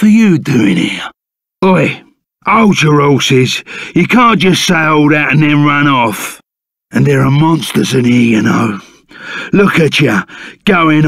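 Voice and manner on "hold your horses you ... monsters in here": ordinary talk, nothing marked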